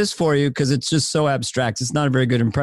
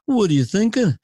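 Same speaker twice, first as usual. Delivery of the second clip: higher Liverpool lilt; 5 semitones higher than usual. Shown as a demonstration. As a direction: model a Liverpudlian question. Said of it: "a Beatle thing".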